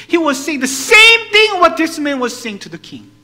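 high, booming emphasis; impassioned preaching. Acted. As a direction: hammer the point home at full force.

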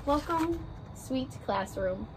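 gentle picture-book read-aloud, rhymes bouncing along; reading to children. A narrator's reading. Teacher reading a class picture book to her first graders, story-time voice.

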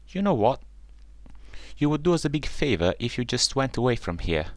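mildly snooty brush-off; taunting. A narrator's reading. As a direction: tell them off in a lifted voice. Duckling taunting the ugly duckling.

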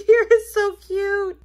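drawn-out coo of delight at the little cow; gushing cute. Real, not acted. Unboxing a cow toy for viewers, slow delighted coo. Cooing over toy's butt.

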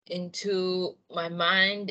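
ordinary talk

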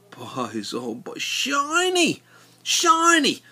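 high, slow marvelling; delighted. Real, not acted. He marvels at the rust-free metal, high and slow. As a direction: marvel at the shine.

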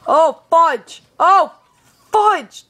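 high alarmed mock curse; alarmed exclamation. Real, not acted. Kid alarmed as his character falls, high loud dismay. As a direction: cry out in dismay. Game goes wrong.

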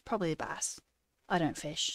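ordinary delivery

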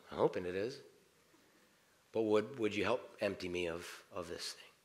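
soft, hushed prayer spoken over the coffee pot; quiet prayer. Acted. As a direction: pray softly, as if alone with God. Prays aloud over coffee.

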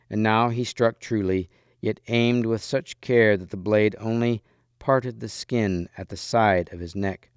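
ordinary delivery, nothing marked

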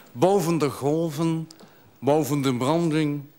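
solemn verse delivery; poetry recitation. Acted. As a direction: recite it line by line with pauses. Recites his poem about a gull.

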